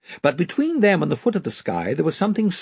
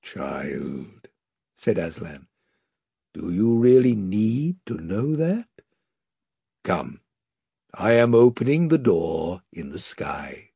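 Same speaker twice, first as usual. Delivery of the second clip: Aslan, solemn lion; 6 semitones lower than usual. A narrator's reading. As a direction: speak with calm majesty. Aslan voiced low.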